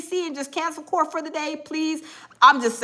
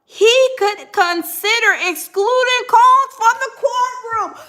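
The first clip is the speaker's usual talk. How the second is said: rising alarm read; 6 semitones higher, 4 dB louder, slower than usual. Real, not acted. Reading judge threat rising.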